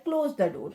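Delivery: low command, dropping on "door"; falling intonation. Shown as a demonstration. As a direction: let "door" fall at the end. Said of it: "डोर में जो है यहाँ पे".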